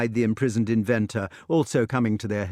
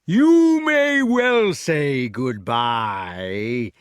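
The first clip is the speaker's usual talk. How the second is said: sneer; 4 dB louder, much slower than usual. A narrator's reading. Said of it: "sneered the giant".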